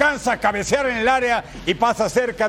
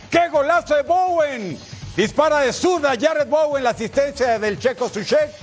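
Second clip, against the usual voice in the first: excited goal call; 5 semitones higher than usual. Real, not acted.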